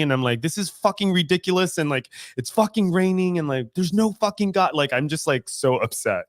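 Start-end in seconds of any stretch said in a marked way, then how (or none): 0.4-4.7 a higher mock rant at the rain, half-yelled; retold angry rant. Retelling a rainy bike ride to a friend, mock angry rant. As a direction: vent like you're furious with God, but only retelling it. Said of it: "I was just so angry".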